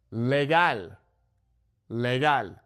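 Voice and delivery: Legal as leh-GAHL, twice; Spanish "Legal" with open a; Spanish pronunciation. Shown as a demonstration. Teacher models vowels, Spanish Legal. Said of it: "focus on the vowel sounds".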